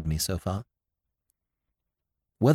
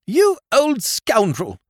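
much higher, slow, outraged cry; indignant young lord; 14 semitones higher, 4 dB louder, slower than usual. A narrator's reading. Lord Drever rounding on Sir Thomas, outraged. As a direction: drag it out at the old knight. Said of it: "added his lordship".

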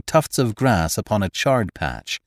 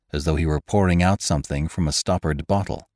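usual speaking voice

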